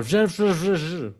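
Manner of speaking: mock mispronunciation, garbled repeated "je"; imitated student error. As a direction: stumble over "je, je, je" in buzzing zh sounds. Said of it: "muchos de mis alumnos hacen el error".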